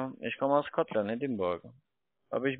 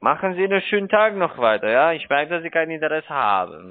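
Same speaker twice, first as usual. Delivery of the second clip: sharply raised, irritated goodbye; annoyed, louder; 8 dB louder than usual. Real, not acted. Scam caller loses patience with an uninterested mark, louder. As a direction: push the words out louder, fed up.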